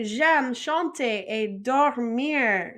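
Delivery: very slow, careful French; slow model. Shown as a demonstration. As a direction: model it slowly for repetition. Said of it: "And then we could say".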